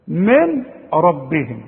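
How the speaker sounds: من ربهم with the nūn swallowed into the r, no nasal hum; slow, drop the nūn in من ربهم entirely; full merging. Shown as a demonstration. Teacher demonstrating full merging to a student. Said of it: "إدغام كامل بدون غنة".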